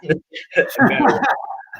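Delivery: a real burst of laughter at himself; self-mocking laugh. Real, not acted. Embarrassed guest laughing at his own schooling remark, real laugh.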